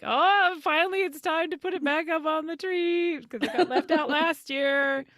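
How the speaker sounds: high, excited squeal. Retold or imitated. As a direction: gush like a thrilled kid. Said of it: "I get really excited".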